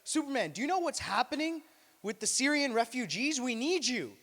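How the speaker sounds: loud, high urgent plea; pleading public. Retold or imitated. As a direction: beg him loudly. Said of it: "we'd be like".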